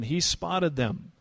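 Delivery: normal talking voice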